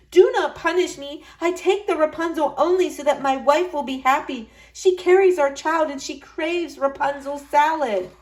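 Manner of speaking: husband begging the witch not to punish him; pleading husband. A narrator's reading. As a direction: plead your case to the witch. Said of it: "begged the husband".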